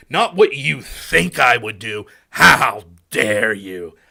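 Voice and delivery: over-the-top mock fury, each how dare you dragged out; mock outrage. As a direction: parody an offended debater losing it. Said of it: "I fucking love that".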